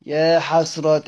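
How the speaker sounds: يا حسرتى with the final vowel held long; drawn-out lament ending. Shown as a demonstration. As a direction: stretch the end of يا حسرتى slowly. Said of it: "لأنك بتمدها كده". (يا حسرتى بمد الآخر لزيادة التفجع)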